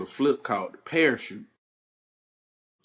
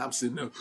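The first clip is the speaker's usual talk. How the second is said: winded gasp; 7 dB quieter, faster than usual. Retold or imitated. Winded by punch to stomach.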